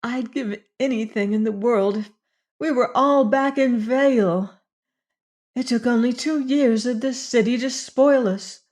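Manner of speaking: high, tearful longing. A narrator's reading. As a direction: plead through tears. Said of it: "eyes filmed over with tears".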